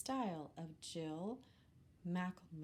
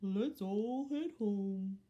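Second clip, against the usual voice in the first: louder, slow cow; 6 dB louder, slower than usual. Acted. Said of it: "said the cow".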